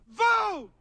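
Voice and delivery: high, loud shout of one word; rallying shout. Shown as a demonstration. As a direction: lead the crowd with a shout. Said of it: "say it so loud".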